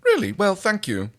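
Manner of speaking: high, pleased alien voice; Pilot's voice. Acted. Pilot pleased by John's news, high voice. Pilot thanked over com.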